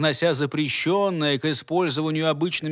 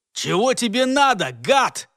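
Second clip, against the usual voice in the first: angry shout; 6 semitones higher, 4 dB louder than usual. Acted. Worker yells at hidden enemy.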